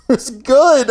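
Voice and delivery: ordinary talk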